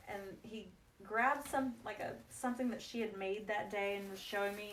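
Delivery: ordinary delivery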